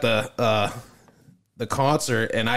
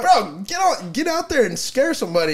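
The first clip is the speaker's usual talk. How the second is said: high mock scolding; 10 semitones higher than usual. Retold telling off scary worker.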